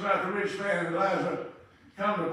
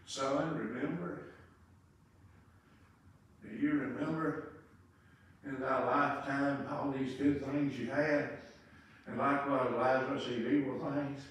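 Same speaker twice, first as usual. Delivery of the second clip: low, soft, grave patriarch; quiet deep stern rebuke; Abraham's voice; 7 semitones lower, 9 dB quieter than usual. Acted. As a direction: speak low and solemn as Father Abraham. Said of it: "Abraham told him".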